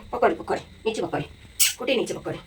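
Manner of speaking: low, soft coaxing murmur. Real, not acted. Grandmother softly urging the baby to crawl away, low and hushed.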